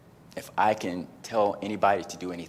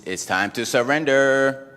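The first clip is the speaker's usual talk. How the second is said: slow, louder, higher commanding voice; slow, higher, louder call to surrender; inner voice; 6 semitones higher, 9 dB louder than usual. Retold or imitated. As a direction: announce it slowly like a calling. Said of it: "that voice came back".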